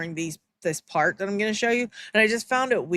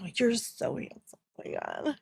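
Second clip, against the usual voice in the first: soft mock swoon, breathy at the end; flirty swooning; 2 semitones higher, 8 dB quieter than usual. Mocking her fawning over Charlie, soft swoon. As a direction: fawn over him, trailing off. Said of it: "She's like".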